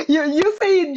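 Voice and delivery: high squeal through a laugh. Real, not acted. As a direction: laugh the word out. Laughing at a viewer's joke.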